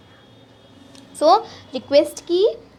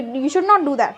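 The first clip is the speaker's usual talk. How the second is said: stern command. Shown as a demonstration. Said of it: "जब हम किसी को कमांड करते हैं".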